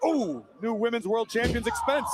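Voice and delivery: ordinary talk, nothing marked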